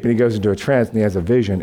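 normal talking voice

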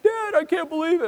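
put-on high, whiny kid voice; stunned son. Son tells his dad after the wave, high and whiny. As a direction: play the wiped-out boy, voice way up. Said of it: "He's like".

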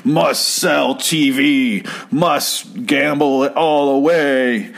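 craving addict chanting his needs; zombie addict. Retold or imitated. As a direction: drone like a hooked zombie.

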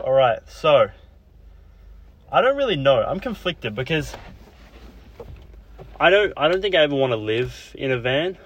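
panting, out of breath. Real, not acted. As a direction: talk between quick breaths. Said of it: "So I'm so out of breath".

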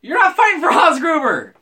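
high, loud, incredulous protest; heated objection. Real, not acted. Podcaster objecting in a playful debate, heated objection. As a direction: shout down your friend's argument.

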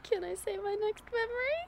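high, soft, laughing as if crying; teary laugh. Real, not acted. Wife giggling before her next memory, teary laughing. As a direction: hold back laughter that sounds like tears. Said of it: "I thought you were crying".

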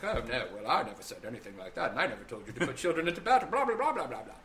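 a hint of smug Palpatine, quickening; lightly voiced smug dismissal. Palpatine brushes off Mace Windu with mild smugness. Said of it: "very smugly being like".

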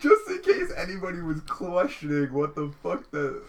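high, loud laughing speech; laughing hard. Real, not acted. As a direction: crack up mid sentence.

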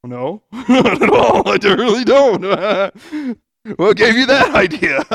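loud, slow, laughing sarcastic no; laughing mock denial. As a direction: laugh it off as a joke answer. Mock answer to his own question.

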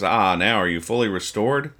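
ordinary delivery

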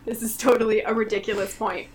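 ordinary talk

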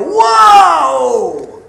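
high, loud shouted whoa like a crowd. Retold or imitated. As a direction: shout it like the whole crowd gasping. Crowd reaction imitated.

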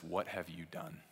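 soft, heavy, grieving question; hushed question. Retold or imitated. God asking us softly about our sin, hushed. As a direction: let it land softly and gravely.

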